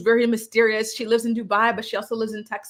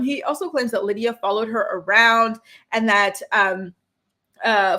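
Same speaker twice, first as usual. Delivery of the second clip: normal talking voice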